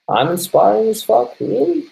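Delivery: high-pitched, drawn-out disbelief; incredulous. Real, not acted. Streamer reacting to a viewer's compliment, incredulous. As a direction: question it slowly, pitch climbing far up.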